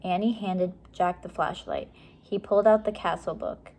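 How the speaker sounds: ordinary delivery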